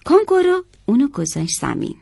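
plain reading voice

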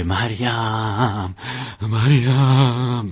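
name wailed out very slowly, stretched long; imitated madman's cry.

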